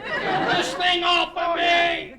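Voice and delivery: high, drawn-out cry to be freed; comic trapped outburst; frantic shout. Acted. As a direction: yell for help while stuck. Mayor trapped, demands to be freed.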